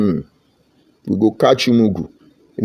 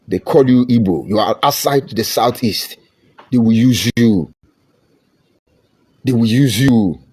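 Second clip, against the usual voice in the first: ordinary delivery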